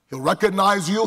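ordinary delivery

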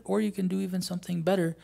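normal talking voice

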